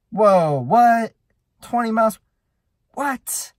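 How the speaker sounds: loud, high-pitched disbelieving outburst; acted astonishment. Rider reading twenty miles per hour on the cyclometer, astonished. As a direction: react in startled disbelief, pitch jumping up.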